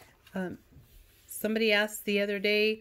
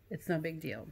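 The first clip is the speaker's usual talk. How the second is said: whispered aside, no voice; 3 semitones lower, 9 dB quieter than usual. Real, not acted. Teacher whispers a reassuring aside to students.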